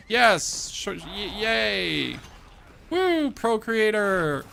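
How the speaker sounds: mock cheer.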